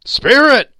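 high, loud desperate call; urgent, imploring shout; crying out. A narrator's reading. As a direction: cry out to stop them. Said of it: "he cried".

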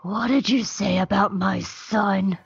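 slow, drawn-out threat; hammed-up slow menace; menacing Rex. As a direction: confront them like a protective father. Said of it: "with a wild look in his eyes".

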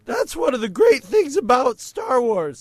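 high, loud put-on teen voice; squeaky teen boy impression; Patrick Delmore voice. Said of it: "says Patrick Delmore".

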